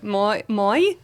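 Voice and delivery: tentative Irish accent. Shown as a demonstration. Guest asked for her accent, tentative Irish. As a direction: try out the Irish vowel. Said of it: "Can we hear your Irish accent?".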